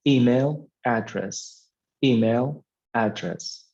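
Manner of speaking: "email" stressed, "address" falling away; stress on first word. Shown as a demonstration. Teacher repeating an example for students, stress on "email". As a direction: hit "email", keep "address" lighter. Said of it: "So the stress is on the first word".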